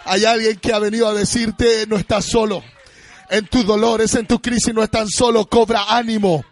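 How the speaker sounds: loud, ringing preacher's voice, half-sung; full-force preaching. Acted. Pastor calling out to his hurting congregation, loud and sung. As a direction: preach it out to the whole church.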